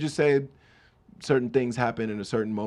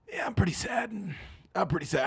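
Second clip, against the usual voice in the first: sad confession; 8 semitones higher, 4 dB quieter than usual. Acted. Acts out his reply at a party.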